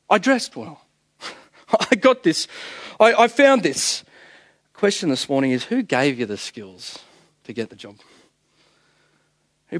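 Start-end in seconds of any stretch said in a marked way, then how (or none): 0.1-4.0 higher, cocky mock swagger; smug self-made boast. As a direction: play the proud worker who did it all himself. Mimicking the self-made worldly attitude.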